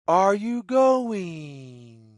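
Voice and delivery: plain reading voice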